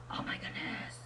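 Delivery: breathy whisper, worn out; whispered exasperation. Real, not acted. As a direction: whisper it to yourself, fed up. Exasperated aside after mocking.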